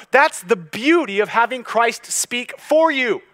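building to a loud, high-pitched proclamation; impassioned preaching. Real, not acted. Preacher proclaiming Christ's defense of believers to the congregation, impassioned and building. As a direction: preach it with rising force and urgency.

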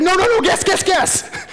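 very high, laughing guess guess; husband begging his wife to guess, very high and laughing; giddy insistence. Retold or imitated. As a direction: squeal through a laugh. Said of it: "He's like".

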